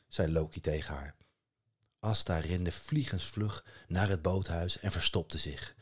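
usual speaking voice

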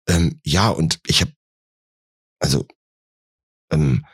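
halting, broken-off attempts to explain; acted hesitant stammer. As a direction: stall between fillers, never finishing the thought. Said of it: "Stammel hier nicht rum".

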